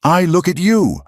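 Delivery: high, pained outcry; cried out. A narrator's reading. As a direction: cry it out to her. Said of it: "Silas cried".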